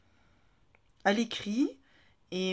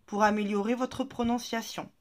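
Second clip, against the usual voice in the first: normal talking voice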